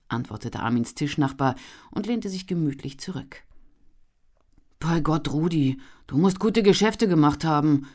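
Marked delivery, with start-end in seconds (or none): 4.8-7.9 louder, envious outburst; envious exclamation. A narrator's reading. Armin envying his friend's success, envious exclamation. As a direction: let the envy burst out. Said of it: "rief Armin neidisch".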